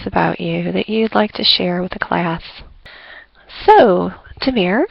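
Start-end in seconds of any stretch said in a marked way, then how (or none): none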